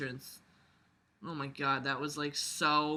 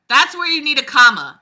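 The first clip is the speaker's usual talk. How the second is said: emphatic correction; 7 semitones higher, 14 dB louder than usual. Real, not acted.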